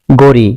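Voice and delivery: করি said as গড়ি with a voiced g; Chittagong dialect pronunciation. Shown as a demonstration. Teacher showing the Chittagong k-to-g shift in গড়ি. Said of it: "ক ধ্বনিকে গ উচ্চারণ".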